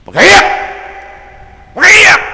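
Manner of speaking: loud, high, mocking yell of "Pag-iyak!"; mock scornful shout. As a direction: shout it like a scoffing macho critic. Mocking society's scorn of crying. (pasigaw na panunuya)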